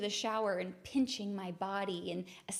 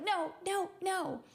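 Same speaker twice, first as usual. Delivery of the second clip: refusing; 9 semitones higher, 4 dB louder, slower than usual. Retold or imitated. Refusing the anxiety's message.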